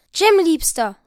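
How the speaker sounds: calling out. Acted. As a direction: call to him across the room, pleading. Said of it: "rief sie". (lauter, flehender Ruf)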